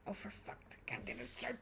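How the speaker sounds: exasperated whisper under the breath; whispered curse. Real, not acted. Streamer fed up with the passenger, whispered curse. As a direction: breathe it out in frustration, unheard.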